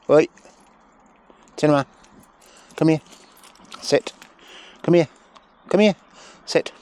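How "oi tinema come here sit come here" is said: normal talking voice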